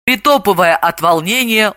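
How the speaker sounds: ordinary delivery, nothing marked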